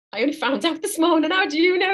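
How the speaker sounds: mock disbelief at the psychic; astonished client. Psychic imitating a client stunned that she knew.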